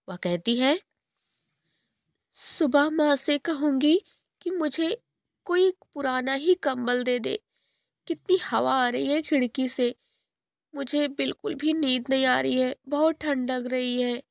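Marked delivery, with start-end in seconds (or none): 2.4-14.2 high and softer, cold and small; soft child voice. Acted. Girl alone on a cold night, high and soft. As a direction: murmur to herself, shivering.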